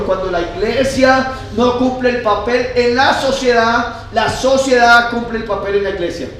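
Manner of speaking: high, forceful quoted maxim; emphatic preaching. Acted. Preacher quotes a wise saying to the church, emphatic proclamation.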